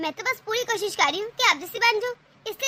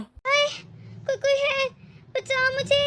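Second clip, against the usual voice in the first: cry for help; 4 semitones higher, slower than usual. Pretend. Mother-in-law faking injury, crying out.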